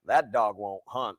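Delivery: low, slow Southern drawl; Southern accent impression. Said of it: "He probably said it like".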